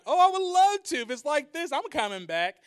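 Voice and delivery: high, loud, gushing delight; overjoyed, can't wait to come back; delighted king. Retold or imitated. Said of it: "And he's like".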